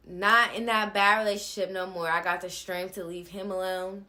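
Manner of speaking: usual speaking voice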